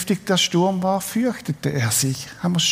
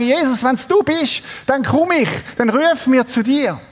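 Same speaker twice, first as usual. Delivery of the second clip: urgent plea; 6 semitones higher than usual. Acted. Acting Peter's plea in storm.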